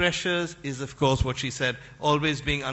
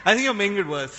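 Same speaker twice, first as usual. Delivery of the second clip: laughing tease; 5 semitones higher, 5 dB louder, faster than usual. Real, not acted. Host teasing through laughter.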